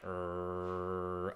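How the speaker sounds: low, quiet rumbling growl; soft, low "rrrr" of a warning dog; dog's growl. Retold or imitated. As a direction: imitate a small dog growling steadily. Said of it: "And he's like".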